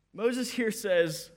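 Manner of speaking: ordinary talk, nothing marked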